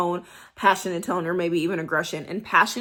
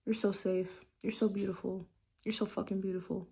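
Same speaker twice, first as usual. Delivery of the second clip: much softer, tender and possessive; 8 dB quieter than usual. Shown as a demonstration. Showing the soft possessive tone she means. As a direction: coo it to a lover. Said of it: "imagine hearing".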